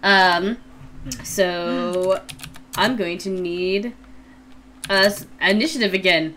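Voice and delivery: usual speaking voice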